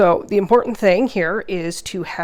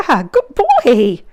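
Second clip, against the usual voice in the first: puppy praise; 10 semitones higher, 6 dB louder, much slower than usual. Real, not acted.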